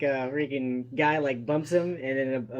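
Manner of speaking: ordinary talk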